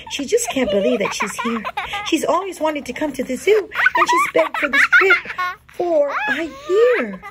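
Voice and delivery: high, laughing excitement rising to a squeal; delighted giggling. Acted. Little Sue laughing with joy at the zoo gate. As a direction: giggle with joy at finally being here.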